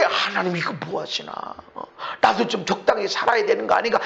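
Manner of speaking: 낮게 투덜대는 흉내; 하나님께 낮은 음으로 푸념하는 척; 불평하는 속마음. Acted. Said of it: "이런 마음이 온다고 그러면". (속으로 불평하듯 낮춰서)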